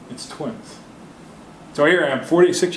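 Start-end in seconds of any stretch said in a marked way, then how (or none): 0.1-0.8 soft, hushed retold punchline; quiet reveal. Wife breaks the news to husband on the phone, hushed.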